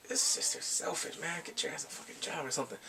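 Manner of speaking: fast, hushed, irritated mutter; annoyed mutter. Real, not acted. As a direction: grumble under your breath. Speaker annoyed at sister.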